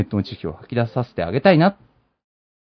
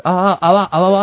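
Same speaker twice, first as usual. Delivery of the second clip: mock panicked babble, a bit higher and louder; flustered awawa; 6 semitones higher, 5 dB louder, slower than usual. Host mimicking the flustered bartender's panic. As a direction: imitate someone flustered going あわわ. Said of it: "あわわになっちゃうんです".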